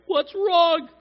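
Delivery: very high, loud mock-frantic yell; exasperated parent. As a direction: shout helplessly over a wailing child. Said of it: "tried to console kids that are just wailing".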